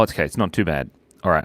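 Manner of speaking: usual speaking voice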